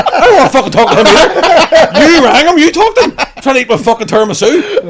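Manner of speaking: very high, loud mock outrage, laughter creeping in; exasperated shout. Diner to the woman pestering him by phone, exasperated mock yell. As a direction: blow up in comic indignation. Acted outburst at intrusive phone caller.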